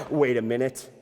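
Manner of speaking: plain reading voice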